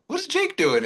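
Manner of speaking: high, incredulous question. Retold or imitated. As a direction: pitch it up in mock disbelief. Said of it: "I was like".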